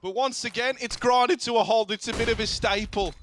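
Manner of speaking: mock Australian play-by-play; Australian caster accent. Still casting the round in his Australian accent. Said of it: "I love doing accents".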